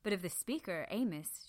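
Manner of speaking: usual speaking voice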